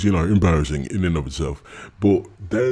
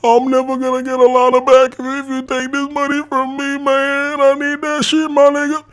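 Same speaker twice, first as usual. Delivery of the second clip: much higher and louder, whining mockery; mocking whine; 4 dB louder than usual. Mocking him begging about his bills, escalating high whine. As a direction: squeal it like a desperate beggar. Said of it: "But then he's like".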